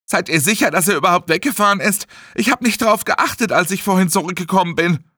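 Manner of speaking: high-pitched, anxious questioning; agitated worry. Acted. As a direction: fret aloud in alarm. Aufgeregte Fragen während des Notfalls. (aufgeregt und besorgt)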